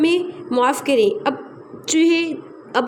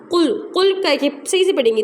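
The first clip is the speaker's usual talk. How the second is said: ordinary delivery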